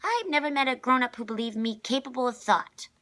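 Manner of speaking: put-on high, loud child complaint; a small girl airing an old grievance; indignant little girl. A narrator's reading. Constance complaining about grown-ups.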